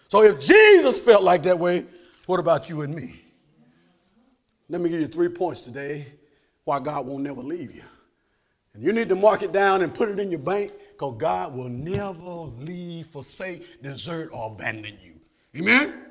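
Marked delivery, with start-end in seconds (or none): none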